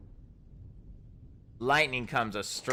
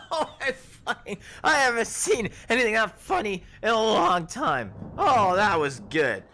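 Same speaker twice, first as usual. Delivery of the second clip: high, loud laughing voice; laughing hard; 11 semitones higher, 6 dB louder than usual. Acted. Merchant mocking Draymond's fall, laughing hard. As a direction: speak through a fit of laughter. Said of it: "She kinda laughs".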